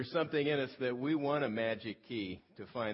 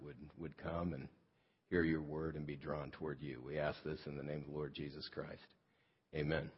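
earnest, measured prayer voice; praying; 3 semitones lower than usual. Real, not acted. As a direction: pray aloud for the church plant. Pastor leads congregation in prayer.

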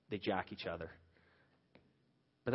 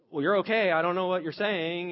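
higher and louder, dismissive snap; irritated retort; 7 semitones higher, 9 dB louder than usual. Retold or imitated. Father to upset daughter, irritated and dismissive. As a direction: brush her off, annoyed.

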